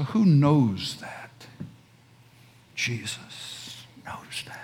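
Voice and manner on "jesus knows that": soft unvoiced whisper; awed whispered reply. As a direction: breathe the answer quietly. Answer after rhetorical question.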